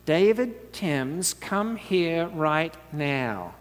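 slow, drawn-out stern summons; stern mother calling. Retold or imitated. Mother calling her son in to face trouble, slow and stern. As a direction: drag each word out like a warning. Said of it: "my mother would sometimes say".

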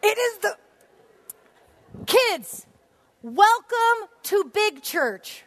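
high, loud, slow welcome; excited welcome. Real, not acted. Preacher welcoming kids to Big Church, excited and loud.

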